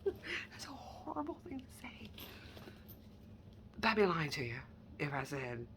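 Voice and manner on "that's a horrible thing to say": hushed, sheepish aside; whispered admission to viewers; guilty whisper. Real, not acted. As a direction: whisper it like a confession. Confessing petty habit, whispered.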